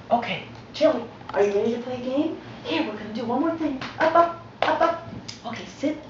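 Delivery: pitched well up, coaxing the dog; chirpy encouragement for a puppy; high, playful voice to a dog; high dog-talk voice. Real, not acted. Talking to the dog in training.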